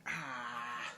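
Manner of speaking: teary sniff from onion fumes; eyes watering, a soft pained sigh; teary from chopping onions. Real, not acted. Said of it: "I'm crying 'cause of the onions".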